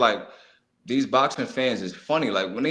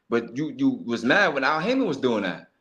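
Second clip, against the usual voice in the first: ordinary talk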